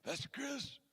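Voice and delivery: hushed, high Godfather-style mobster voice; Glenn. Acted. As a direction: imitate a menacing mob guy very quietly. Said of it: "he talks like this".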